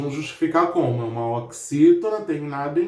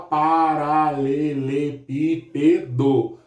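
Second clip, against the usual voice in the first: slow, separated syllables; much slower than usual. Shown as a demonstration. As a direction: break paralelepípedo into drawn-out syllables, pa-ra-le-le-pí-pe-do. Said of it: "sete sílabas".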